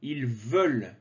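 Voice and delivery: "veulent" said "veul", the ENT not sounded; silent ENT. Shown as a demonstration. Teacher showing learners "Ils veulent" said "il veul". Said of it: "Donc le E N T ne se prononce pas".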